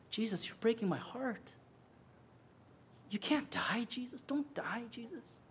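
breathy pleading whisper fading to nothing; heartbroken whisper. Retold or imitated. Peter whispering to Jesus, heartbroken hushed plea. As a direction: beg him not to die, barely audible.

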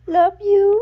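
put-on sing-song "Love you" pitched high and loud for the toddler to copy; mother coaxing toddler to repeat: high "Love you"; high coaxing model word. Shown as a demonstration. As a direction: coax the baby, high and bright. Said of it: "nói đi con".

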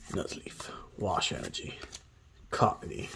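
whispering names to himself; whispered card names. Real, not acted. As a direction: mouth the card names under your breath. Murmuring card names under breath.

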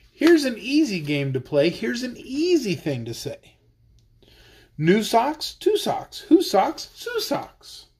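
lively sing-song read-aloud; reading to children. Acted. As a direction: read the rhyme playfully to kids. Reads tongue-twister book aloud.

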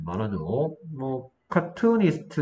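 normal talking voice

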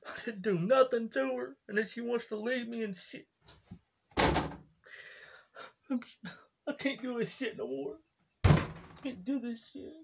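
put-on whimpering about his girlfriend, half-hearted sobs; crying type rager. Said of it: "like crying type emotional".

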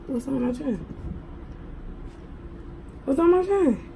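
ordinary talk

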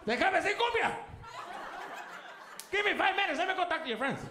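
high, excited mock dad. Dad eager to show uncle to friends, excited high voice. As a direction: get giddy to show off your brother. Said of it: "They dicen cumbia".

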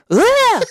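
high piercing mock scream; imitated curdling scream. Friend poked in the eye, mock bloodcurdling scream. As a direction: shriek it like a sudden hurt cry.

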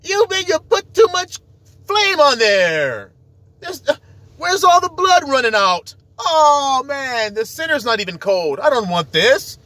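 hammed-up high whiny complainer; mocking impression. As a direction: mimic a fussy diner whining about his steak. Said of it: "y'all be like".

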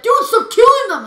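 high, loud mock outburst; exaggerated shouted impression of a friend; imitated scolding yell. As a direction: yell it as your annoyed teammate would. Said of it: "whenever I touch your zombies".

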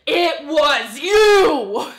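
slow accusing yell; loud accusing shout. Acted. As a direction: drag out the accusation. Accusing cartoon character.

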